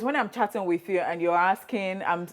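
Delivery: usual speaking voice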